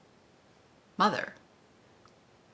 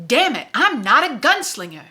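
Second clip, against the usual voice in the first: higher and louder exasperated exclamation; frustrated outburst; 6 semitones higher, 7 dB louder than usual. Acted. Susanna protests to Roland that she is no gunslinger. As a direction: snap it out in frustration.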